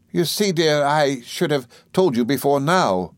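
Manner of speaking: lightly voiced nurse explaining herself; slow and high, easing into a confession; Peggotty. A narrator's reading. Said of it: "said Peggotty".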